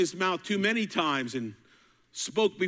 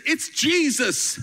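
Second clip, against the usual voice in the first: high, slow, awed cry; excited recognition; 10 semitones higher, 5 dB louder, slower than usual. Retold or imitated. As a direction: call it out in wonder. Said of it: "somebody said".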